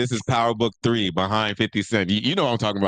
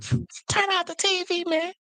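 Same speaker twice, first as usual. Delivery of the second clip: mock upset falsetto; high upset voice; 3 dB quieter than usual. Imitating his co-host watching his sports team lose, high upset squeal. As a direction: mimic a friend's voice shooting very high in upset. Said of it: "my voice can get high when I'm upset".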